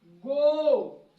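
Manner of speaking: गो with a long, loud drawn-out o; long vowel. Shown as a demonstration. Teacher shows students the long vowel in गो, loud. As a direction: stretch the o in गो. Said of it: "vowel का उच्चारण लंबा होगा".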